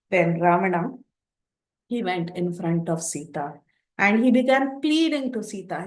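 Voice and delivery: ordinary delivery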